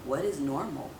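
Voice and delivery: low, hushed, blank question; deadpan question. Retold or imitated. Retelling her clueless reply to the panicking friend, low and deadpan. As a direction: ask it flat and dazed, barely voiced. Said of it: "And I was like".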